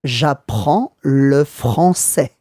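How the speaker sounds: slow, syllable by syllable "J'apprends le français"; slow teacher demo of "J'apprends le français"; said slowly. Shown as a demonstration. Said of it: "I'll say it slowly".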